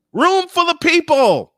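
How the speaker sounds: loud, high, slow proclamation; grand announcement. Retold or imitated. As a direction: boom it out like a host presenting. Boss introduces him to conference.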